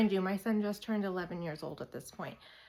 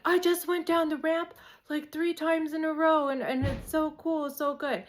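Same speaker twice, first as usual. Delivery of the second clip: high, loud, breathless boast; thrilled kid; 7 semitones higher, 4 dB louder than usual. Retold or imitated. Son telling mom about the ramp, thrilled and high.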